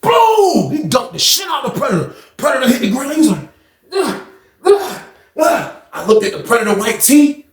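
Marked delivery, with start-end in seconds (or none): 0.0-0.7 higher punchy boom; mock slam boom. John slams the predator, mock boom. 3.9-5.8 higher mock grunts; pained groans. Beaten predator groans on the floor.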